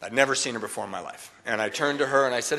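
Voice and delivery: ordinary talk, nothing marked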